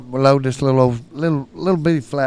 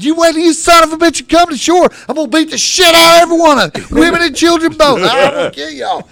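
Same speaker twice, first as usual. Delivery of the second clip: loud, high, rapid-building tirade; enraged retold threats; 13 semitones higher, 8 dB louder than usual. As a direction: rant at them from the shore, hammed-up. Retold rage, threats from shore.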